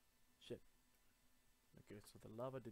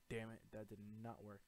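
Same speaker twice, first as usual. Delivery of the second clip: normal talking voice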